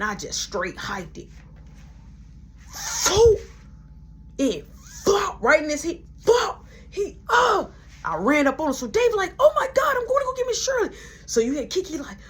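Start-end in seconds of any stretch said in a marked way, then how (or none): 2.7-3.4 high, loud mouth sound effect of a can hitting; cartoon impact noise; can hit sound effect. 6.2-6.6 loud, high shouted flop noise; comic splat cry; impact yelp. Retold or imitated. The can lands on his head. 9.4-10.9 much higher, loud alarmed voice; a startled coworker running for help; frantic yelp; panicked coworker. Retold or imitated. Coworker panicking during the fight.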